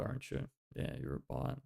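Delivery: ordinary delivery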